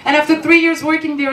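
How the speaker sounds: ordinary delivery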